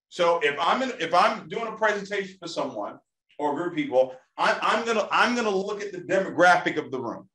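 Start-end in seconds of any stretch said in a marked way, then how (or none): none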